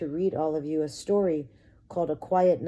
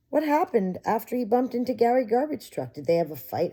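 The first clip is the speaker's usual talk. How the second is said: put-on curious child; 7 semitones higher, 4 dB louder than usual. Child asks.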